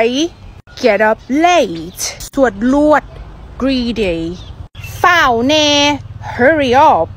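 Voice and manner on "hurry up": ordinary delivery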